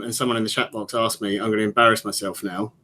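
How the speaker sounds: ordinary talk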